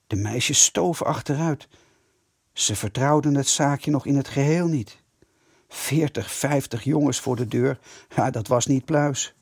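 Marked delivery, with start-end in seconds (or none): none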